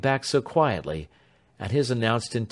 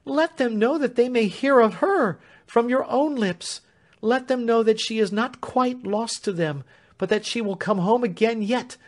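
high, pleading and urgent; agitated servant pleading with Warden; sheer agitation; 12 semitones higher, 4 dB louder than usual. A narrator's reading. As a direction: beg him, voice climbing. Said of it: "clapping her hands in sheer agitation".

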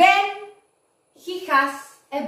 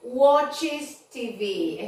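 slow model; much slower than usual. Shown as a demonstration. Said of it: "otro verbo que cambia".

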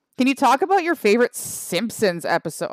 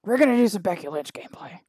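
ordinary talk

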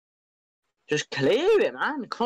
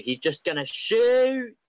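excited shout; 10 semitones higher than usual. Real, not acted. Shot chance in live commentary.

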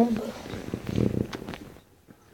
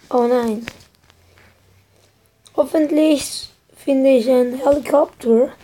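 ordinary delivery

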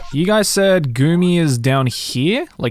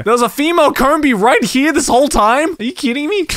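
high, raised, incredulous outburst; indignant disbelief; 10 semitones higher, 3 dB louder than usual. Real, not acted. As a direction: protest in exasperated amazement. Finds what he wanted was nearby.

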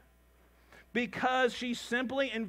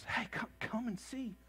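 soft urging invitation; 9 dB quieter than usual. Retold or imitated. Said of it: "that just goes".

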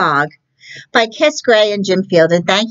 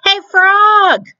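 much higher, brighter-voiced cat calling out; 4 dB louder than usual. Librarian reading to kids, cat hails frog in high voice.

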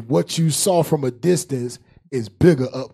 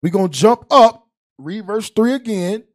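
preaching; 4 semitones higher, 6 dB louder, slower than usual. Acted. Preaching at full force.

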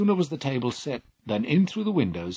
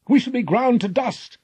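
much higher, louder cry of terror; terrified cry; 14 semitones higher, 8 dB louder than usual. Acted. Crew fearing the clashing cliffs, terrified cry. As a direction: panic at the crushing cliffs. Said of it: "cried the Argonauts".

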